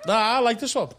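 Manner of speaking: ordinary delivery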